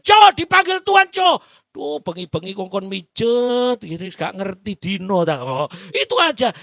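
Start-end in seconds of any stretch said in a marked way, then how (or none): 0.0-1.4 loud, high call of the master. Acted. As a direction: call Jo sharply from across the house. Master calling the slave. (panggilan keras sang majikan)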